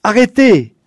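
loud sharp order; commanding shout. A narrator's reading. Father ordering the mob to stop, a loud shout. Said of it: "ordonna-t-il".

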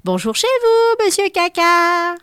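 very high, loud, slow drawn-out yell; children shouting. Acted. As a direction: call up to the balcony at the top of your voice. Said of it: "Les enfants crient en contrebas".

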